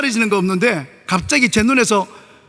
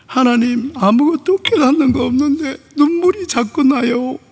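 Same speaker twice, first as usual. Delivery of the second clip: tearful prayer; 3 semitones higher, slower than usual. Retold or imitated.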